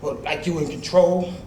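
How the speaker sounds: ordinary talk, nothing marked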